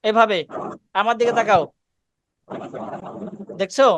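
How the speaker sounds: ordinary talk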